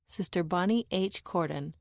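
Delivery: plain reading voice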